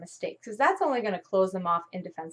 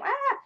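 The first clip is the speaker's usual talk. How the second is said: high mock wail of a crying child; a short hammed-up cry, much higher; mock child's howl; imitated crying wail. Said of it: "And she started crying".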